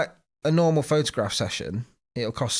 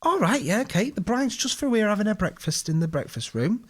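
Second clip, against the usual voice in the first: much higher, bright mock receptionist voice; imitating receptionist; 9 semitones higher than usual. Receptionist directing the photographer to the bride, in a much higher mock voice. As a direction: put on a chirpy hotel receptionist.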